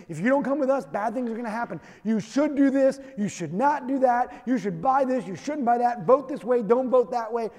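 loud, insistent scaremongering commands; fear-mongering voices. Retold or imitated. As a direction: bark orders like a scaremonger selling fear. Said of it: "trying to scare you into believing".

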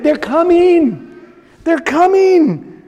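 drawn-out slow mock urgency; slow, lightly voiced warning; slow urgent warning. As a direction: stretch each word, lightly acted fugitive. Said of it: "he's like".

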